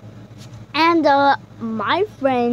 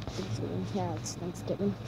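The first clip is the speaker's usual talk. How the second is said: muttering; 6 semitones lower, 14 dB quieter, faster than usual. Real, not acted. Muttering answers to himself.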